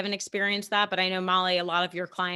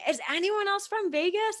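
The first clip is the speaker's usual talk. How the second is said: calling out; 11 semitones higher than usual. Retold or imitated.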